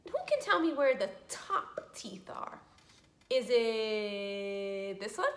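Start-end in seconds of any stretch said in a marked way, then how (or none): 3.3-5.3 mock questioning.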